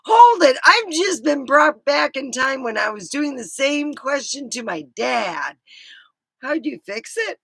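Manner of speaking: high, loud excited questioning; laugh-tinged Hold it, fired up; mock-outraged mom, high and loud; excited disbelief. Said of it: "I'm like".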